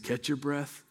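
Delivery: put-on calm the winded boy down softly; gentle and soft. Dad soothing his winded son after the tree, softly. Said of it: "very compassionately, very gently".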